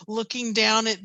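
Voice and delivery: ordinary talk, nothing marked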